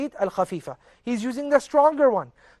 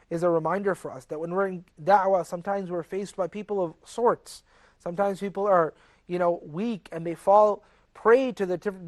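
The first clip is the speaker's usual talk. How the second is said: ordinary talk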